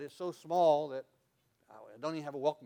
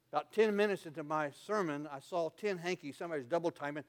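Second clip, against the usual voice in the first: ordinary talk, nothing marked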